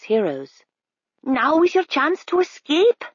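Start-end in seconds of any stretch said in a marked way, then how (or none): 1.2-3.0 high bird voice; high eager raven. A narrator's reading. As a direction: urge them on as a helpful bird. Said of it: "said the raven".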